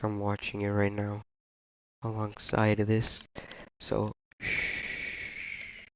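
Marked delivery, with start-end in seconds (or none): 4.4-5.9 soft, drawn-out shush; a quiet, conspiratorial shh; hushing shh. Real, not acted. Streamer watching another race on the side, hushing.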